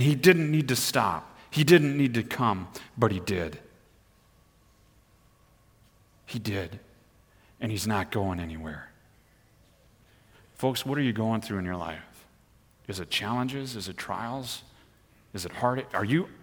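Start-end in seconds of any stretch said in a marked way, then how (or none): 3.0-8.9 much lower and softer, quiet conviction; soft sincere pulpit hush; tender reassurance. Acted. Tender turn after preaching climax. 10.5-16.3 softer, intimate questions to the congregation; hushed caring pastor; gentle pastoral questions. Acted.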